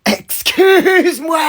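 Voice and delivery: drawn-out, very high, loud "excuse-moi"; slow, high and loud mock indignation; meme catchphrase impression. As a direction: stretch it out slowly, shrill and outraged. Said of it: "J'allais le faire, mec".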